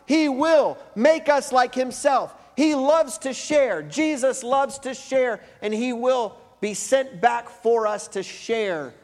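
slow, emphatic preaching, building loud and high; forceful preaching. Real, not acted. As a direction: proclaim each promise with full force.

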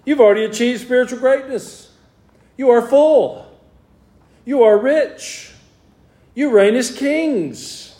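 drip sarcasm on each phrase; mock sarcastic praise. Paul mocking the proud Corinthian church, sarcastic. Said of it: "the sarcasm there".

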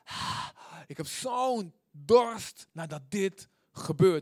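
loud, slow, heaving breaths before the words; pant, then push out each word slowly; panting with thirst. Shown as a demonstration. Preacher showing how to pant for God, breathless longing. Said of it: "open je mond en hijg".

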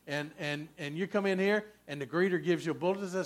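ordinary talk

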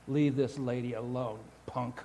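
low, quiet tough-guy warning; mock tough threat. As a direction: play a hero quietly warning a thug. Joke hero threatens street thug.

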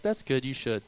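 low, soft deadpan dig; deadpan teasing. Retold or imitated. Big brother teasing his sister, soft deadpan. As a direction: tease her with a dry low jab. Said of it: "I was like".

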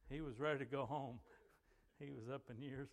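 normal talking voice

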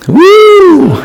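high, loud joyful woo; excited whoop. Real, not acted. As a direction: let out a happy cheer. Cheering after the verse.

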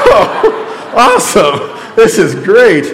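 wry laughing awesome; laughing sarcasm. Retold or imitated. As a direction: fake cheer cracking into laughter. Hernia news, sarcastic reply.

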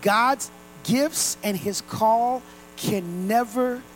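each word of scripture laid down slowly; slow deliberate reading. Shown as a demonstration. Pastor reads the new version slowly to the congregation. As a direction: read it slow so every word lands. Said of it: "Let me read it in the oth-, in the other version".

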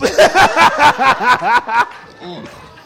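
high burst of laughter; loud laugh. Real, not acted. Laughter after joke.

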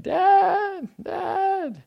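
put-on high, loud child's cry for dad. Father imitating his child crying out at night, high wail. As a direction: wail it like a kid woken by a nightmare.

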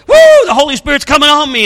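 high, loud whoop into excited cry; mock a worshipper overcome, high and loud; hog-wild worshipper. Preacher mimics people going wild at a conference, hog-wild whooping. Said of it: "they're saying things like".

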